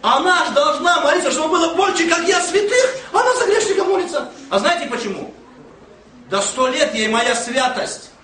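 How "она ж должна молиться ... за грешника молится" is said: high-pitched, rising comic outrage; mock indignation. Pastor protesting that granny prays for a sinner, mock indignant. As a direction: act out wounded self-righteousness, climbing higher as it goes.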